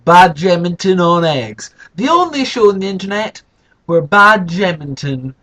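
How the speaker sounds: grand slow showman welcome; show host intro. Acted. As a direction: announce the show like a big TV presenter.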